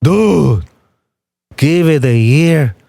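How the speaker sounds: mock Logan's odd accent; lightly voiced Logan Paul drawl; Logan Paul impression. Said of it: "how Logan talks like".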